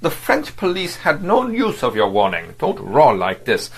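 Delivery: exasperated superior telling him off; irritated rebuke. Acted. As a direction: snap at a blustering underling. Vladimir rebuking Verloc.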